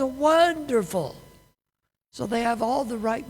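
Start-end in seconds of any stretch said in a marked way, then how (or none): 0.0-1.2 "Wonderful." stretched slowly, pitched higher, mock-bright; drawn-out cheery "Wonderful.". Caller answering news of a death, drawn-out high "Wonderful.". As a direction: sing out "Wonderful." slowly and high as an automatic reply. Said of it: "She says".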